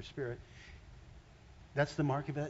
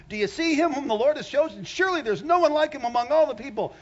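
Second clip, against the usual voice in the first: 1 proclaiming loudly; 9 semitones higher, 9 dB louder than usual. Acted. Preacher proclaiming scripture loudly.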